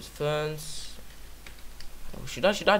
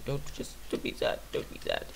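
whispered comfort; 7 dB quieter than usual. Acted. Comforting viewers about shorter episodes.

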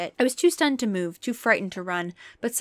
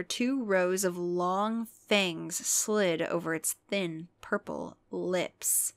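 usual speaking voice

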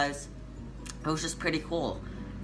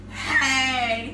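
sharp loud call, much higher; sudden shout; 12 semitones higher, 6 dB louder than usual. Real, not acted. Vlogger shouting suddenly while her sister sleeps, loud call. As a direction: blurt it out loud.

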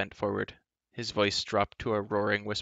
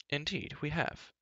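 eager prince; 6 semitones higher, 6 dB quieter than usual. A narrator's reading. Prince cheerfully puts in.

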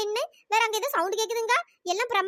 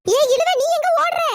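loud, high shout after someone fleeing; sharp raised-voice challenge; calling out; 6 semitones higher, 7 dB louder than usual. Acted. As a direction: yell to stop her running off. Calls after fleeing friend.

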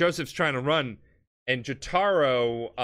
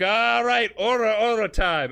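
hyped-up battle cry; 8 semitones higher, 5 dB louder than usual. Retold or imitated. Jotaro about to punch Dio.

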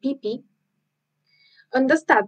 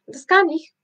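put-on high, louder child's voice; Pippi boasting; 7 semitones higher, 4 dB louder than usual. Pippi volunteering to fight the strong man, high confident child's voice. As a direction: chirp it out full of confidence. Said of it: "sagte Pipi".

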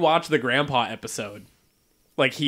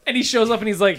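usual speaking voice

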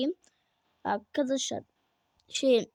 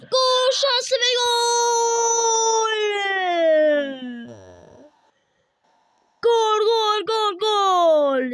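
drawn-out goal shout, louder; screaming football commentator; 9 semitones higher, 8 dB louder, much slower than usual. Acted.

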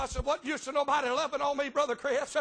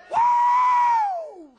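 long, very high, very loud whoop; Woo! held out as a joyful cry; ecstatic whoop; 13 dB louder than usual. Real, not acted. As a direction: let out a jubilant holler. Ecstatic climax after prayer.

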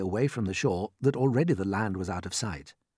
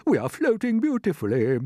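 put-on loud, pitched-up, stately old character; old green grasshopper; 12 semitones higher, 6 dB louder than usual. A narrator's reading. Grasshopper confirms James's news to everyone, stately. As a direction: pronounce it grandly as an old grasshopper. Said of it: "the old green grasshopper said".

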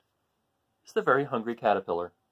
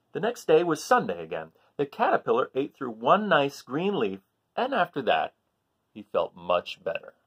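louder, higher storybook reading, building with each day; animated picture-book narration for a class; reading to children; 8 semitones higher, 8 dB louder than usual. Acted. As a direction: read the list with growing excitement.

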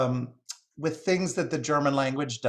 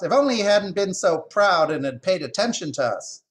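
high, loud bird complaint; squawking jay; 7 semitones higher, 6 dB louder than usual. Acted. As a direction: squawk your reproach in a high voice. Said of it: "the jay squawked".

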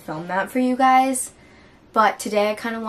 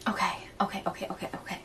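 whispering; 10 dB quieter, much faster than usual. Real, not acted. Steadying herself in tears.

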